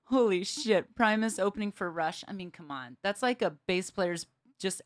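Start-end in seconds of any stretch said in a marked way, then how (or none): none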